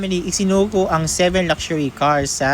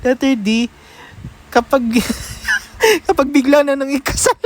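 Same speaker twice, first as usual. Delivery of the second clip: ordinary delivery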